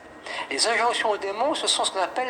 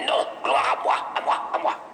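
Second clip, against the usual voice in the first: whispered, breathy demon taunt; 6 semitones higher than usual. Retold or imitated. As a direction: hiss it like the demon mocking. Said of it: "Il dit". (démon qui chuchote, moqueur)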